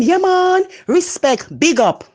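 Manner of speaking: imitating tourists doing a Jamaican accent, pitch jumping high; hammed-up Jamaican catchphrases as outsiders say them; foreigners' mock Jamaican. Mocking how foreigners imitate Jamaicans, Jamaican accent. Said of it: "hear them".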